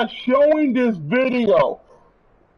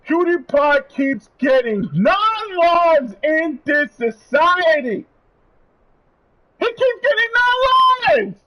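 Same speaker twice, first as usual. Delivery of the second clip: indignant shout; 5 semitones higher, slower than usual. Real, not acted. Angry rant at industry.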